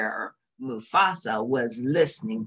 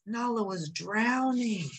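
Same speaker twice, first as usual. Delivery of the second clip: gasping fear; 8 semitones higher, 4 dB quieter than usual. A narrator's reading. Friend drowning in the river.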